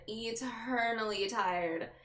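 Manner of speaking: "Eternally tired" stretched out slowly, every syllable dragged; drawn-out tired echo. Shown as a demonstration. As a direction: slowly savour "Eternally tired" like a weary sigh. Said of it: "your voice sounds tired".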